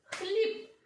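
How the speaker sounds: high rising Хліб; Хліб said high for children; high teacherly call. Shown as a demonstration. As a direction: sing Хліб up high to the class. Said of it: "Розкладемо на частинки".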